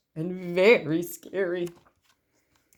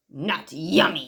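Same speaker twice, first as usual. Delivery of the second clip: bellowed; 4 semitones lower than usual. A narrator's reading. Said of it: "he bellowed".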